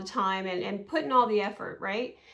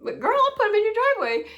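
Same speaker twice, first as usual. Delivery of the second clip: high, loud, fast sass; warning the mom the son will land on her driveway; sassy threat; 10 semitones higher, 5 dB louder, faster than usual. Retold or imitated. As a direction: fire it back cheekily.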